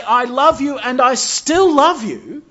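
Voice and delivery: loud, high, slow cry of love, leaning on still; emphatic divine love. Acted. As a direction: proclaim it with full force. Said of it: "How can God say".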